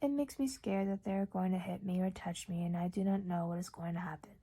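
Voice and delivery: plain reading voice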